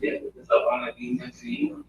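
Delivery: words slurred from drinking. Real, not acted. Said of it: "You're slurring your words".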